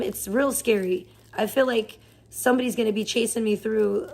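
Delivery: ordinary talk, nothing marked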